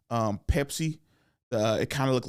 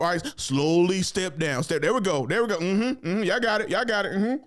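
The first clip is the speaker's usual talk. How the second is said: bossy coach voice; 5 semitones higher than usual. Retold or imitated. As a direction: boss them around like a lazy supervisor. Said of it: "that person coaching you".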